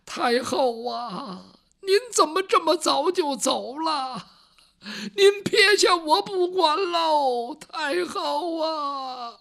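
high, slow wailing for the dead empress dowager; wailing cry. Acted. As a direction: sob out the lament, drawn out. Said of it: "这郭槐哭起来了". (拖长声音哭喊太后)

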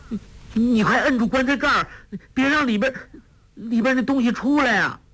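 high, fast, stammering panic; terrified urging; frightened. A narrator's reading. Terrified of what's in the coffin.

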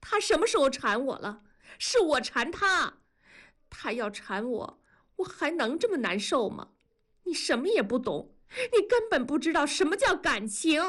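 high-pitched, hurt outburst; upset shouting. A narrator's reading. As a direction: cry out in wounded protest. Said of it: "冲着文丽喊". (委屈激动地喊)

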